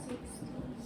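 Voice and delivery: whispering the answer to self; breathy unvoiced whisper. Real, not acted. Student works out answer.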